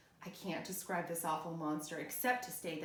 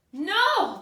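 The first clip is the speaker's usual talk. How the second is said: high, loud urgent no; yell; 12 semitones higher, 12 dB louder than usual. Acted. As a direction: yell to stop him. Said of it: "the monster yelled".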